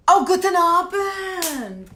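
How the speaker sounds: high, slow, drawn-out German greeting; hammed-up German hello; bad German accent. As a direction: loudly put on an awful German accent. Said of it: "Don't make me talk in my German accent".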